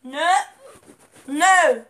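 loud repeated no; loud protest. Real, not acted. Gamer protesting as his run fails, loud no no no. Teammate dying in game.

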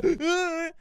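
high, strained fake gasp; mock dying gasp. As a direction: gasp as if the heart gives out. Acts out his heart giving out.

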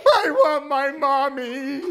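put-on baby owl crying for his mother. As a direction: wail it like a tiny owl wanting Mommy. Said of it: "cried Bill".

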